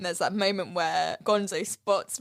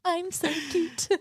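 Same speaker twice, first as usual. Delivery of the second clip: cute little angel; 8 semitones higher than usual. Retold or imitated. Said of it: "Tiny Tim's being a little angel".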